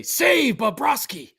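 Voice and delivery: slow, emphatic mock announcement. Shown as a demonstration. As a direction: announce it slowly like a goalie chant. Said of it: "you should just say".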